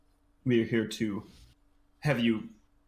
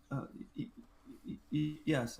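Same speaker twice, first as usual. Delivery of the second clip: soft, higher, halting stammer; nervous stammer; 5 semitones higher, 7 dB quieter, faster than usual. Acted. Acolyte answering her host, nervous stammer. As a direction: stumble quietly toward the answer.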